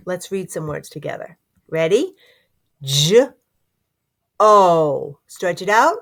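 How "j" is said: normal talking voice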